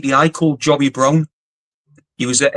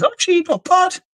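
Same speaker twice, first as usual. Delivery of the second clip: high-pitched mock approval; much higher put-on voice for a retold compliment; imitated Scottish guy. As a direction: mimic his grudging praise, pitched right up. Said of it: "he says".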